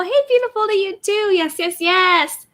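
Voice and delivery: high sing-song reply; high, stretched "yes, yes, yes"; excited greeting. Real, not acted. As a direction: beam back at the fan.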